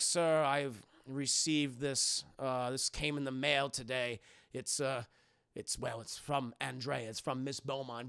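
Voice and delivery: halting, subdued butler voice; lightly voiced grave manservant; hesitant butler. Alfred hesitantly brings bad news.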